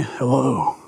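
a mock Batman growl of a greeting at 3 a.m. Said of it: "in the best Batman voice I can".

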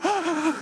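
high breathless gasp of pain; mock gasp. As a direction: act out the wind knocked out of you. Breath knocked out by paddle.

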